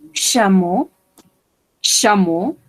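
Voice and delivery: "chameau" ending in a pure O sound; eau as O. Shown as a demonstration. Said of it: "trois lettres ici comme une O".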